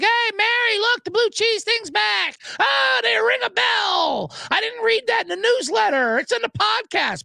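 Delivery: hammed-up clerk all thrilled about blue cheese; gushing store clerk. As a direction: squeal it like an overeager Trader Joe's employee.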